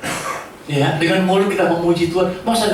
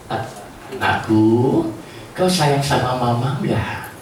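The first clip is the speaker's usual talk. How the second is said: slow, lower and tender; gentle mother; 5 semitones lower, much slower than usual. Shown as a demonstration. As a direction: coax the child lovingly. Gentle mother asks her child.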